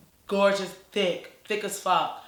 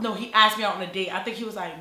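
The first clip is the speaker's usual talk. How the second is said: ordinary talk, nothing marked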